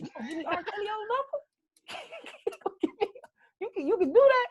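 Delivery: high-pitched, laughing incredulity; mock disbelief. Acts his amazed reply to salesman.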